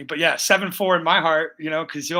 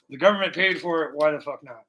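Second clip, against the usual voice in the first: normal talking voice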